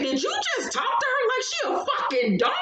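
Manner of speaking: outraged shout. Retold or imitated. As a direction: shout it at him, appalled. Brianna confronts him, retold.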